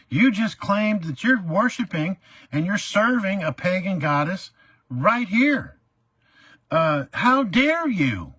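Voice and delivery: high, loud, slow "how dare you"; hammed-up courtroom fury; dramatic outrage. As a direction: pour it on like a scandalized believer. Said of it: "I got a little, uh, dramatic".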